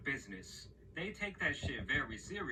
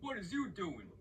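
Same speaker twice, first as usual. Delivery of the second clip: put-on higher New Jersey cop accent; 7 semitones higher than usual. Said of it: "the most New Jersey accent of all time".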